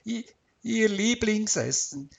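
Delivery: high, stammering tearful reply; sniffling. A narrator's reading. Bereaved husband answers the doctor, sniffling. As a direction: stumble on the first word, near tears. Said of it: "Max schnupfte".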